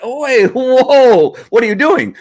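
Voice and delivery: sudden high startled "whoa"; startled surprise. Retold or imitated. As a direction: caught off guard, pitch jumps up. Trainer reacts to dog's trick.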